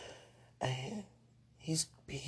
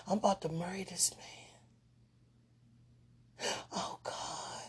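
hushed dreamy whisper; 6 semitones higher, 6 dB quieter than usual. Real, not acted. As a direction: gush it in a whisper. Whispered gushing.

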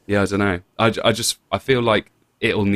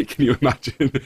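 laughing; 6 semitones higher than usual. Real, not acted. Laughing before the mock accent.

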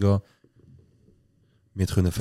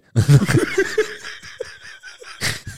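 breathy, very high burst of laughter. Real, not acted. (lautes, hohes Auflachen)

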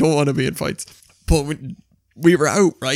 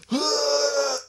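put-on wheezy drunken gasp; 10 semitones higher, 5 dB quieter than usual. Drunk stumbling outside apartment.

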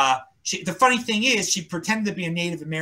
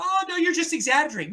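mock dismissive; 10 semitones higher than usual. Mocking excuse-makers.

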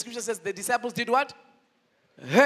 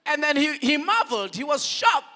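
usual speaking voice

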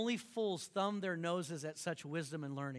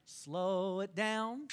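very slow, stretched slow it down; teaching the congregation to slow it down; slow and drawn out; much slower than usual. Shown as a demonstration. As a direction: draw every word of slow it down out. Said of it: "Say it with me".

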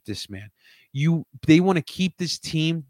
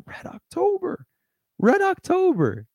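much higher and drawn out; hyped. Acted. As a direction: savour the name like a chant. Hyping the playoff run.

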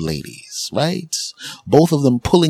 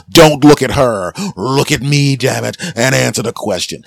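stern prosecutor; 4 semitones higher, 4 dB louder than usual. Acted. Prosecutor cutting off the witness, loud and stern. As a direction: bark it like a courtroom prosecutor.

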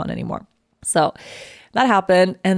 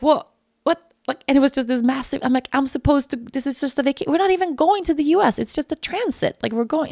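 high, stunned protesting; shocked protest; 7 semitones higher than usual. Retold or imitated. Arguing with the check-in agent, stunned. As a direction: disbelief rising in the voice.

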